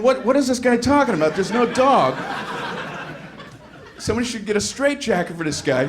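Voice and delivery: mock baffled passerby.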